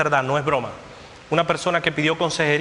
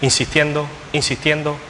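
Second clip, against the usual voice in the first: full-force preaching. Acted. Preacher thundering to the congregation about God's judgment. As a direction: proclaim it to the whole church at full force.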